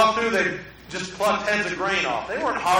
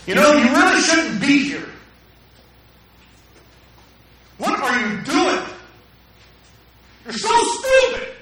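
harsh, louder and rising to a high mocking jab; angry indignant voice; 7 semitones higher, 5 dB louder than usual. Shown as a demonstration. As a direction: sneer it at them like a scornful critic. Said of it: "How do people sound when they're mad?".

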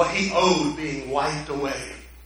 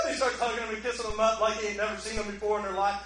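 normal talking voice

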